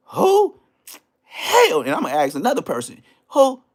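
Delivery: high, loud puzzled "Who?"; mock the homies on the phone who never heard of him. People on phone not knowing him.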